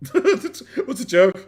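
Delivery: slow, laughing through the words. Real, not acted. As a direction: laugh as you ask it again.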